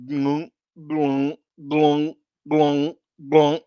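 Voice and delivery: slow glugging sound of a thick pour; thick pour noise. Shown as a demonstration. Imitating a heavy beer pouring out as glunk sounds. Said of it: "it's like".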